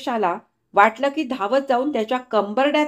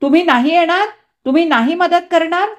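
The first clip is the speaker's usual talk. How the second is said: hysterical accusation; 8 semitones higher, 6 dB louder than usual. Acted. Hysterical accusing questions to family.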